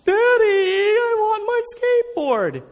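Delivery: put-on high, slow, whiny child's voice; boy crying to his father for his lost skateboard, high and slow; crying little boy. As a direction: play the weeping kid begging his dad. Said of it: "He's crying".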